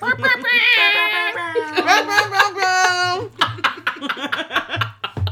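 big high laugh; loud laughter. Real, not acted. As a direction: burst out laughing. Laughing burst mid-intro.

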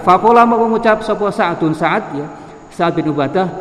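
ringing word-by-word recitation; kitab recitation. Acted. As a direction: intone the text with long-held syllables. Reads the kitab with word-by-word gloss.

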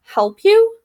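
very high, loud scoff; a high, loud scoffing raven; indignant raven. Acted. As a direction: scoff at the nerve of it. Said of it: "said the third raven".

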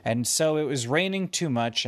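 usual speaking voice

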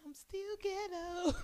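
high, drawn-out mocking voice. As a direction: squeak it out slowly as a send-up.

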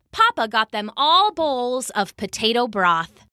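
plain reading voice

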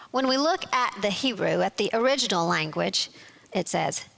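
usual speaking voice

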